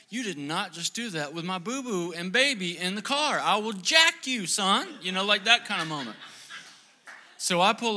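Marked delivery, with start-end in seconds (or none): 0.1-4.9 defensive threat. Retold or imitated. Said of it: "I'm like / not furious, defensive".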